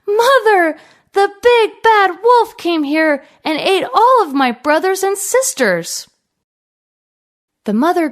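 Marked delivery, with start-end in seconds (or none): 0.1-6.1 put-on crying child. A narrator's reading. Little goat tells mother, high distressed cry. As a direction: blurt out the bad news in distress. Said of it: "he quickly ran to her and cried".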